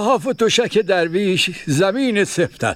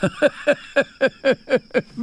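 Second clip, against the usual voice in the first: a kind, knowing laugh; amused chuckle; 6 semitones higher than usual. Acted. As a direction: chuckle at his friend's fears. Laughs at the other's worry.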